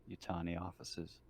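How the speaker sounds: normal talking voice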